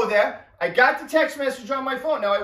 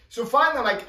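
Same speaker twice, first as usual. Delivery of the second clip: normal talking voice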